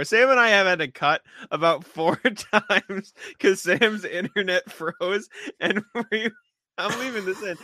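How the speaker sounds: chuckling through the words; laughing. Real, not acted. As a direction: still giggling while explaining. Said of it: "we've been laughing for, like, five minutes".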